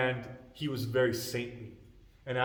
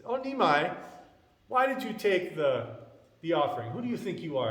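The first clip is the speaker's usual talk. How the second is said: louder, higher mock scolding; mild chiding; 6 semitones higher, 4 dB louder than usual. Neighbour women scold Nimai over the offering, mild chiding. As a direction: chide a little child, leaning on the words. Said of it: "they're chiding him a little bit".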